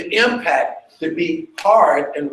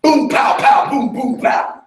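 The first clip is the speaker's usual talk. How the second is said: "boom, pow" shouted much higher and louder; explosive shouted sound effects; forceful percussive shout; 8 semitones higher, 4 dB louder than usual. Shown as a demonstration. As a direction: blast each sound like a drum hit. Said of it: "that's not a dynamic of".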